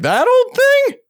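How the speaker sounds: put-on very high, loud "That old thing?"; mock innocence. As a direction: act shocked anyone would mention it. Feigned dismissive innocence.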